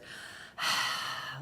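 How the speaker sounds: airy, voiceless sigh out; relaxing exhale. Shown as a demonstration. Meditation leader relaxing the shoulders, airy sigh. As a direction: let the breath fall out.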